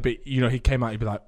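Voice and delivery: ordinary talk, nothing marked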